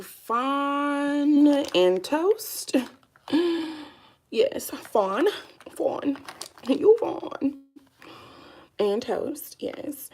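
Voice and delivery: light up as you remember; excited recognition. Real, not acted. Vlogger excitedly remembers her concealer shade names.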